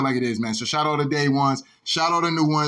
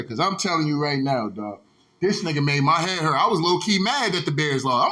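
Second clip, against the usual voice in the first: ordinary talk, nothing marked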